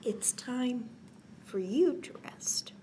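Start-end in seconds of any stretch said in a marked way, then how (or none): none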